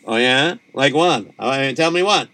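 plain reading voice

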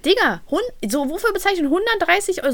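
aghast at paying a hundred thirty euros; price outrage. Retold or imitated. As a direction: throw up the price in disbelief.

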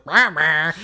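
two mock cat yowls; imitated cat creature yowl. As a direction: yowl like a protesting cartoon cat. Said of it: "and the Palico's like".

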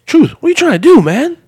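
high, loud hammed-up protest; mock exasperation. [name] asking Truth what he's doing, mock exasperation. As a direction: voice shoots way up in mock disbelief.

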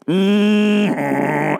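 long, steady foghorn drone; foghorn hum. Retold or imitated. Imitates the foghorn he hears.

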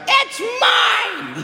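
high mock shout; defiant yell back. Daughter yelling back at her dad, high mock shout. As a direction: yell it back at him over your shoulder. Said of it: "she's like".

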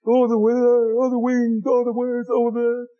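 mock whiny moaning about everything. Preacher mocking gloomy believers, mild mock whine. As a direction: sigh out each oh like a grumbler. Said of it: "too many Christians in the life today who says".

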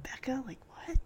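hushed, whispered mock reaction; whispered disbelief. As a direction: whisper it as a puzzled aside. Hushed aside reacting to the nickname.

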